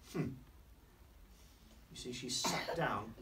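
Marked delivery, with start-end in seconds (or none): none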